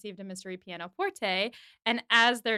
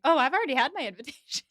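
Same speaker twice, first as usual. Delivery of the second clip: smug; 5 semitones higher, 5 dB louder, much faster than usual. Retold or imitated. Knightley gloats about invitation.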